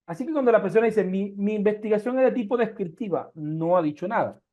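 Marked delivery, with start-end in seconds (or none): none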